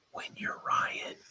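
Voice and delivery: whispered, self-mocking aside.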